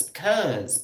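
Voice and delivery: usual speaking voice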